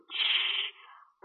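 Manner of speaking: soft, sharp "shh"; shushing hiss. A narrator's reading. Climber silencing his companion, hushed shush. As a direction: shush someone secretly.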